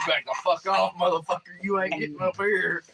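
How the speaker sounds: high, loud furious yelling; scared and angry. Retold or imitated. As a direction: yell him off the tier. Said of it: "It's like".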